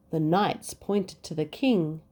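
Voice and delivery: usual speaking voice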